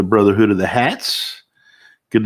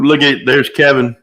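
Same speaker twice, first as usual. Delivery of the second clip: ordinary talk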